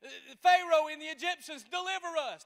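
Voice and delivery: loud, high plea to God; crying out. Acted. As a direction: beg God for rescue, loud. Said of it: "that said".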